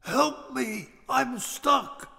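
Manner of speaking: high, soft, strained far-off call; muffled shout from chimney. A narrator's reading. Stuck Santa calls from the chimney, high muffled plea. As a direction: call out small and high, as if wedged inside. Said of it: "shouted a voice from the chimney".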